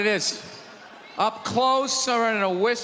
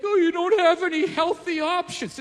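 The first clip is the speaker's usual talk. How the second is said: higher, mocking whine of a customer; whiny complainer; 8 semitones higher than usual. Comedian mocking customers whining about healthy options. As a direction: mimic a complaining out-of-shape customer. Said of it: "That you're like".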